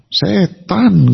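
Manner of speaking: emphatic Betawi or East Javanese, stretched syllables; glaring, weighty East Java delivery; firm East Javanese accent. Shown as a demonstration. Preacher contrasting East Javanese with Solo, firm and emphatic. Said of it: "Mantep, Pak. Melotot lagi".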